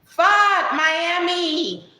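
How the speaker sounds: loud, high exasperated yell; high-pitched hollered impression; Jessica. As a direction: imitate a very loud woman yelling. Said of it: "She loud as hell".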